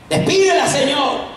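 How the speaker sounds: high, insistent appeal; insistent plea. Acted. Disciples tired of the woman appeal to the Lord, insistent.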